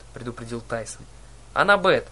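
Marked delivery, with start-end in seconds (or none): 1.5-2.1 higher, louder urgent call; yelled name. A narrator's reading. Boy calling his friend in the cab, yelling. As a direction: call out to her in alarm. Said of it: "завопил я".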